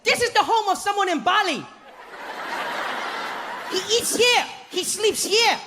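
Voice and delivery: very high, bubbly guide greeting; overeager tour guide. Retold or imitated. Tour guide greets sleepy tourists, very high and bubbly. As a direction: gush like a way too happy guide. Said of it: "he's like".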